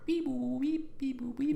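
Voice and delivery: high robotic beeps; beeping synth imitation. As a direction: beep it like an eighties synth score. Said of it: "kinda techno-y score".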